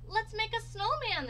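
high, loud eager cry; storybook children's cheer. A narrator's reading. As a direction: call it out like excited kids. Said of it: "the children cried".

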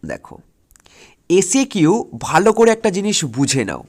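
ordinary talk

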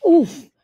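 sharp winded grunt; knocked breathless by the bump; startled oof. Acted. Collides with a big man.

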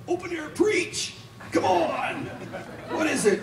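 high, slow, laughing mock panic; squeaky laughing self-parody; mock frantic preacher. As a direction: ham up a flustered street preacher. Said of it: "Do I get a speaker and go".

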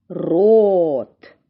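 рот with a long, loud О; slow рот, rolled Р and held О; drawn-out word. Shown as a demonstration. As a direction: stretch the vowel in рот right out. Stretches word for sound analysis.

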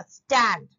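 "Stand" hit loud and firm; stressed syllable. Shown as a demonstration. Teacher showing the third syllable, "stand" stressed loudly. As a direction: punch "stand" as the strong beat. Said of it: "ये एक stress syllable हुआ".

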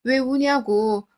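ordinary delivery

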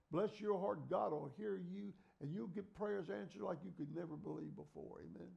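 normal talking voice

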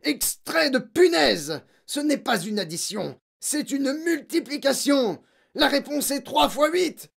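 high, forceful, hammering tirade; furious tyrant headmistress; screeching rage. A narrator's reading. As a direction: berate the pupil at full tilt. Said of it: "glapit mademoiselle Legourdin".

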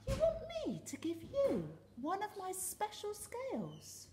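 high, loud indignant fish; scornful and offended; haughty outraged cry. Acted. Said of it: "cried the rainbow fish".

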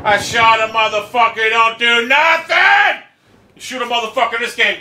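real rage building to a high shout; very agitated. Real, not acted. Gamer whose shots did nothing, agitated shouting. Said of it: "very agitated right now".

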